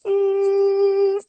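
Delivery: single high-pitched "ee" tone; sharp high "ee", held briefly; high "ee" vocal sound. Shown as a demonstration. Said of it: "So if I go".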